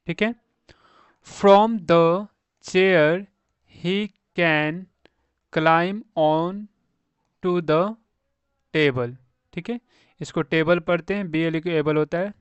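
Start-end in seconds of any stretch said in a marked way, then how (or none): 1.3-9.1 very slow, long gaps between words; slow model reading. Shown as a demonstration. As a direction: read word by word for beginners. (बहुत धीरे, शब्द-दर-शब्द पढ़ना)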